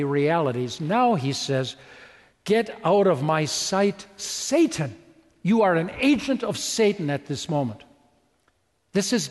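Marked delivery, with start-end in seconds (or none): 2.4-7.1 stern rebuke. Retold or imitated. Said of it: "about blew his ears right off".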